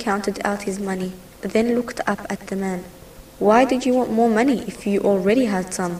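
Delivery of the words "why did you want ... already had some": ordinary delivery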